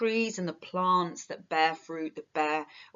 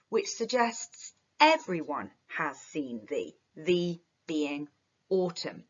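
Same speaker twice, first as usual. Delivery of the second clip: ordinary talk, nothing marked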